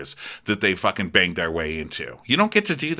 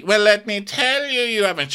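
high, louder interrupting voice; mock Diana cutting in; 12 semitones higher, 3 dB louder than usual. Podcaster voicing Diana's interruption, high and pushy. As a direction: cut her off in a high voice. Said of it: "And Garcelle goes".

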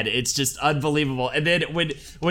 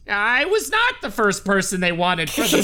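higher, lightly nasal-free voice of a fussy actor; imitating a famous actor's voice, higher; Wallace Shawn impression; 6 semitones higher than usual. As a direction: read it as the actor himself, higher. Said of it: "I'll do a quote in his own voice".

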